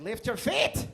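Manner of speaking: high, loud barked order; mock exasperated yell. Boyfriend yelling at his girlfriend dragging her feet, loud and high. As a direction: shout at her to lift her feet. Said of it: "I'm like".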